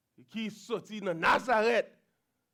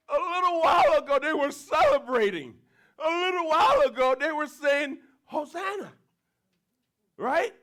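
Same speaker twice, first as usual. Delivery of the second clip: louder, higher, fast pleading; impassioned preaching; 7 semitones higher, 6 dB louder than usual. Real, not acted. Preacher stressing the crowd praised God, fervent and loud. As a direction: drive the contrast home with fervor. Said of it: "preacher's heated emphasis".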